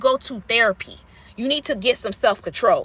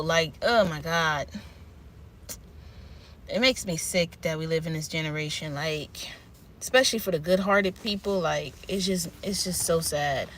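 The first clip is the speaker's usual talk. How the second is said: soft, sad near-whisper; sad hush; 6 semitones lower, 8 dB quieter than usual. Real, not acted. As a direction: say it like it hurts to admit. Sad about mean generation.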